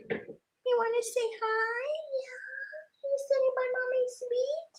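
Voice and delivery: squeaky high pet voice to the cat. Real, not acted. Crafter cooing to her cat in a high pet voice.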